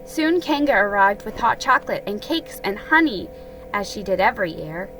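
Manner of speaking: plain reading voice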